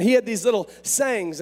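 ordinary delivery

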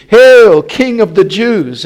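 loud, high hailing cry; mock salute. A narrator's reading. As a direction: hail him with a big mocking shout. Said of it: "began to salute him".

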